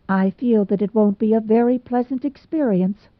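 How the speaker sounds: ordinary delivery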